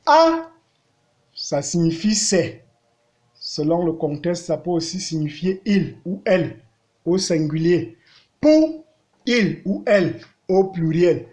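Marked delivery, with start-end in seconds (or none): 0.0-0.5 "A" high, level and loud. Shown as a demonstration. Teacher reads aloud respecting tones, high level "A". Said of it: "respectant les tons". 8.4-8.8 "Pou" high, level and loud. Shown as a demonstration. Teacher reads aloud respecting tones, high level "Pou". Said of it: "respectant les tons".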